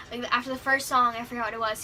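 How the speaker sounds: ordinary talk, nothing marked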